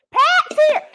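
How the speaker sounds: high thrilled shout of welcome; light up because Pat joined; excited squeal. Real, not acted. Cook spots Pat Neely in the live chat, squeals with delight.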